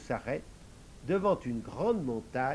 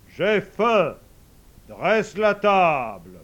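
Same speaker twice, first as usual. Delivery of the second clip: louder, slow lordly command; majestic old man ordering; 4 semitones higher, 11 dB louder, much slower than usual. Acted. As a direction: bark out the order to the servant.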